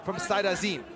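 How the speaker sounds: loud, high excited call. Real, not acted. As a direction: react to the big hit with a burst. Commentator reacts to a big elbow.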